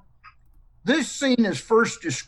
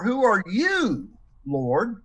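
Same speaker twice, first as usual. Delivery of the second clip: "you" pitched higher and stretched, slower; stress on YOU; 4 semitones higher, slower than usual. Shown as a demonstration. Teacher gives his class a second reading, stress on "you". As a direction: hit "you" up high, then ease off on "Lord". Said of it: "if we change emphasis of the words".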